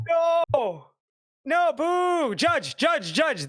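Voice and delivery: high-pitched booing and calling out; protesting outburst. Real, not acted. Co-caster calling a judge over the game, protesting.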